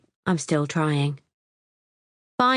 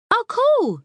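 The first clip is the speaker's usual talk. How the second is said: much higher, suddenly bright and eager; brightening up; 5 dB louder than usual. A narrator's reading. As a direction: perk up with delight.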